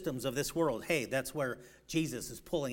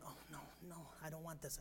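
frightened plea; 18 dB quieter, much faster than usual. Retold or imitated. Ruth retold, upset by conflict.

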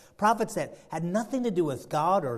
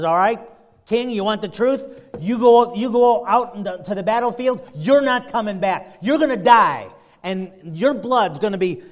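loud, higher, emphatic warning; acted blunt pronouncement; 4 semitones higher, 8 dB louder than usual. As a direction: hit each doom-laden phrase hard. Prophet acted delivering blunt doom.

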